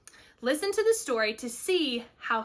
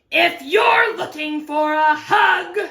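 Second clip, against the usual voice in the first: loud, emphatic cactus voice; gruff offer; 4 semitones higher, 8 dB louder than usual. Acted. Cactus to owl on the roof, loud and gruff. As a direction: announce it forcefully.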